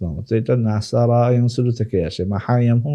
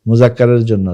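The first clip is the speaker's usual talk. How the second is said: usual speaking voice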